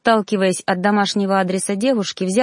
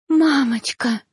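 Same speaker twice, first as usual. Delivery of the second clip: sobbing; 4 semitones higher, slower than usual. A narrator's reading. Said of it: "рыдая в голос".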